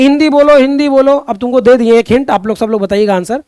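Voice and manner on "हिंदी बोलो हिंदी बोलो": raised, slightly louder pushy chant; higher-pitched mock demand, repeated; mock insistent demand. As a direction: imitate people pressing a language demand. Voices people demanding a language.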